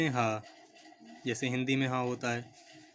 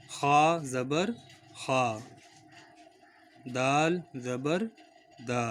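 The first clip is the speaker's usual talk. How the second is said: slow spelling drill; much slower than usual. Shown as a demonstration. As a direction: drill it aloud for pupils to repeat. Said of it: "آپ ہمارے ساتھ repeat کرتے رہیے". (ہجے کی آہستہ مشق)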